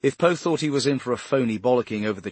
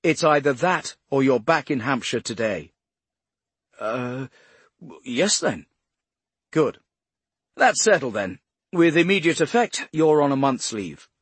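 firm director; 4 semitones higher, 6 dB louder than usual. A narrator's reading.

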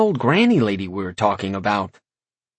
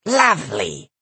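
put-on sneering old lady; 3 semitones higher, slower than usual. A narrator's reading. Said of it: "the old woman sneered".

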